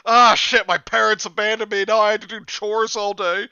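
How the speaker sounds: high, loud exasperated whine; loud mock outrage. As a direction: whine it loudly. Loud mock character reading.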